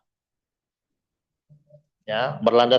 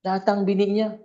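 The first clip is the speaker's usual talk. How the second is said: ordinary talk, nothing marked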